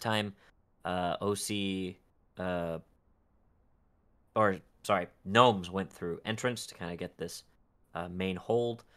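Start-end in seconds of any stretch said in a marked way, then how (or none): none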